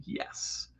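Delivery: breathless whispered yes. Retold or imitated. Viewers reacting to the Trump trial, whispered. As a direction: hush it, just air. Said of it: "twelve of you just went".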